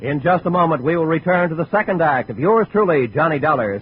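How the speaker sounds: ordinary talk, nothing marked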